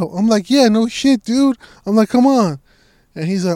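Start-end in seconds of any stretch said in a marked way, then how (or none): 0.5-2.6 high, loud retold impatience; retold exasperated urging. Kid hurrying his dad after the dogs, exasperated. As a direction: urge him along, voice pitched up.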